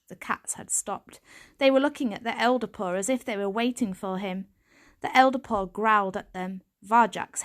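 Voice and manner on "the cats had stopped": usual speaking voice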